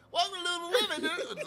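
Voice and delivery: high-pitched Lululemon greeter; high store greeting voice. Retold or imitated. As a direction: put on a high welcoming falsetto. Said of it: "I would go into my high pitch".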